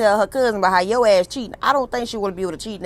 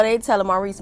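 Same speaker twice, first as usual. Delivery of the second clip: usual speaking voice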